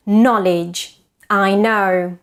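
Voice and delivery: ordinary delivery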